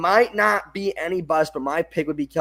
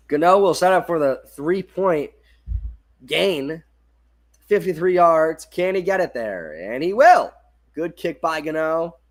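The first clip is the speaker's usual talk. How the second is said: rising suspense to a louder payoff; play-by-play call; 3 semitones higher, 4 dB louder than usual. Acted. Calling the long Giants field goal, suspenseful play-by-play. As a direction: build the kick, then land "And he will".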